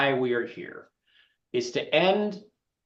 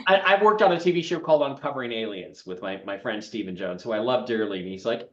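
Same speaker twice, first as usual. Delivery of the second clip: ordinary talk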